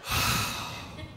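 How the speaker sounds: long breathy exhale; relieved sigh. Acted. Sugar addict after gulping soda, relieved sigh. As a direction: let out a slow, satisfied sigh.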